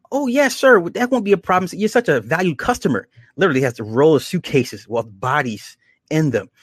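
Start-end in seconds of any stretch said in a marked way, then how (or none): 0.1-3.1 high, sugary customer-service voice; mock-sweet stewardess, much higher; fawning stewardess. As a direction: play the overly polite flight attendant pitched way up. Stewardess fawning over frequent flyer.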